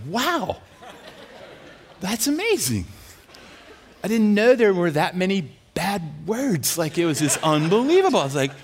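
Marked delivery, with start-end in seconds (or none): 0.1-2.9 high, amazed wonder; mock awe. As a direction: marvel as if truly impressed.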